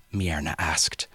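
usual speaking voice

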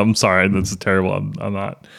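normal talking voice

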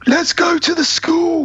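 high, drawn-out imitation of Loomis; mock proclamation. Loomis grabs Jamie for no reason, high slow mock proclamation. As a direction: announce it slowly with the pitch pushed right up. Acting out Loomis's sudden odd announcement.

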